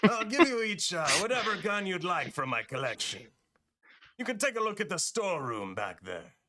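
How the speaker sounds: high merchant offer in a put-on accent; loud, high opening that falls away; Marcus. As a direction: make the offer like an accented shopkeeper. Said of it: "so he says".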